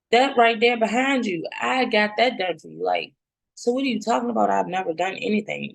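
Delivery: reliving the hurt as she quotes herself, near tears; high, teary protest at her friend; tearful retold plea.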